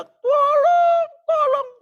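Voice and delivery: very high, loud cry for help; imitated panicked scream. Mother shouts for help for fallen child, imitated high scream. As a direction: mimic a frantic mother screaming. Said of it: "mamanya bingung teriak".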